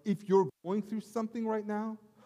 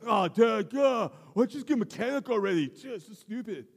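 put-on whiny teen; 5 semitones higher, 5 dB louder than usual. Son whining at dad.

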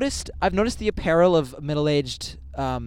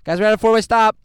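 mock announcement; 5 semitones higher, 9 dB louder, faster than usual. Real, not acted. Announcing to the listeners.

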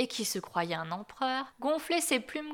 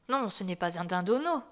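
plain reading voice

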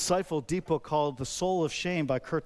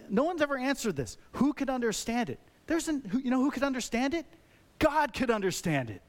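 higher-pitched, insistent challenge; indignant, raised-pitch questioning; some anger; 8 semitones higher than usual. Real, not acted. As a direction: press the question with a touch of bitterness. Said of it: "maybe I'm dealing with some anger here".

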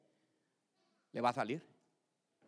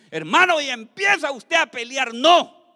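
loud, high preaching shout; rally them and land hard on No; forceful exhortation; rousing battle cry; 8 semitones higher, 11 dB louder than usual. Acted. Urges believer to fight temptation.

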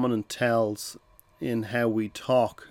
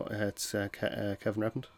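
hesitant, apologetic; 8 dB quieter than usual. Shown as a demonstration. Caller unsure of himself, softly stumbling over his name. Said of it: "you're probably not going to get through".

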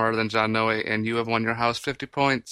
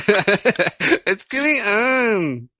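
laughing; 8 semitones higher, 4 dB louder, much slower than usual. Real, not acted. Echoing the confused caller.

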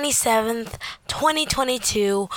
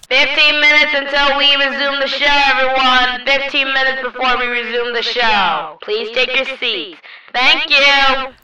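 theater announcer over the PA, loud and level; PA announcement; 4 dB louder than usual. Retold or imitated. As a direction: make a public address announcement to the audience. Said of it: "looked up at the P A system".

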